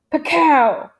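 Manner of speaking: loud, high "pakow" rifle crack; imitated gunshot. A narrator's reading. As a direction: punch out a gunshot noise. Narrator voices a rifle shot.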